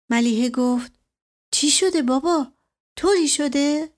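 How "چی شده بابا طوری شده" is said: higher worried daughter's voice; a frightened girl asking what's wrong; anxious daughter. A narrator's reading. Daughter asks her father what happened, higher and anxious.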